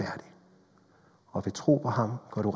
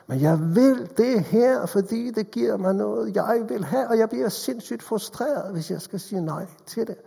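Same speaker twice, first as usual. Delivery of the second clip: stubborn sinner's voice; 9 semitones higher than usual. Acted.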